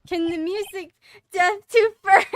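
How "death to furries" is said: high loud shriek; shrieking laugh. Real, not acted. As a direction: shriek in disbelief. Shrieking at chat.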